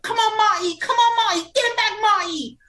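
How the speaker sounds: put-on high, loud British-accented cheering; imitated British fan shouting from ringside. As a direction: yell encouragement in a British accent. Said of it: "[name] in her British accent".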